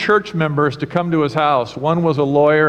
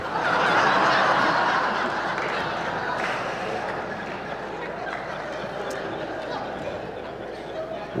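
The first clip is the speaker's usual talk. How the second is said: audience laughter; 9 dB quieter than usual. Real, not acted. Congregation laughing at joke.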